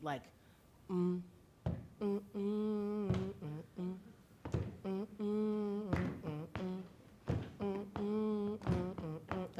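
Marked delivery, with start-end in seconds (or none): 2.0-9.6 hummed bass beat. Shown as a demonstration. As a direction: hum a steady bass beat. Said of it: "maybe we could get, like, a bass beat".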